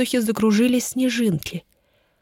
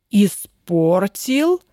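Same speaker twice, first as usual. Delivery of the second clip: slow, stretched indignant outburst; exploding in indignation; 3 dB louder, much slower than usual. Acted. Lord explodes at Ellen over the spoiled game, drawn-out indignant outburst. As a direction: draw out the word in disbelieving outrage. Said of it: "взорвался лорд".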